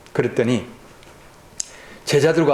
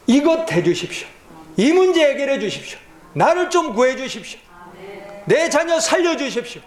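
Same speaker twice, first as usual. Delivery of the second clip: pleading prayer; 11 semitones higher than usual. Acted.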